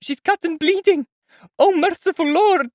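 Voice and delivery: high, loud cry of alarm; alarmed exclaim. A narrator's reading. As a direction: gasp out in horror. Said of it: "Sonia exclaimed".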